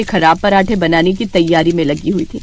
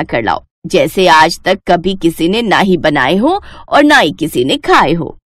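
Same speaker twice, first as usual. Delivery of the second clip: lower, measured mother-in-law voice; stern mother-in-law; 6 semitones lower than usual. Acted. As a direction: speak as the wise old mother-in-law, firm and knowing. Mother-in-law explains the test. (धीमी, गंभीर सास की आवाज़)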